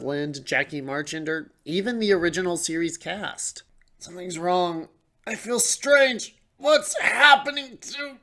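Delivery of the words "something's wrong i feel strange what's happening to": rising panic, high and slow; growing alarm as something changes. Acted. Transformation scene, strange feeling.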